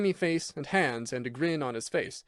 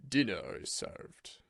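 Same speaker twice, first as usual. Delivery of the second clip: softer, slow formal butler; butler's announcement; 3 semitones lower, 5 dB quieter, slower than usual. A narrator's reading. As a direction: announce dinner with stiff dignity. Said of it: "announced Bates".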